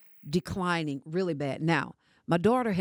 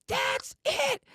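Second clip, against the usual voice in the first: daughter's outburst. Retold or imitated. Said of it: "She jumps up".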